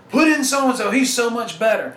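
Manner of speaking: mimicking a fan calling for the backup; imitated fan complaint.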